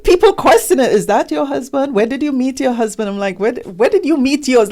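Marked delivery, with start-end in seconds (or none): 0.9-3.1 higher-pitched, imitating prying questioners; mimicked interrogation, pitched up; mock nosy questioning. Acting nosy people questioning her marriage. 3.4-4.7 quick, higher-pitched comeback; acted-out challenge, fast and high; retold indignant retort. Throws the nosy question back.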